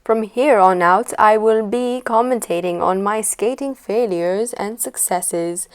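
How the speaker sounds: loud, put-on commentator accent. Skater announcing commentary on her own failures, mock commentator accent. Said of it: "what that commentator accent was".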